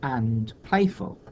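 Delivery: "Cats are really and playful" slow, with a pause; measured read-out. Shown as a demonstration. Teacher testing the line without a word, slow measured read-out of "Cats are really and playful". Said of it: "the line would read".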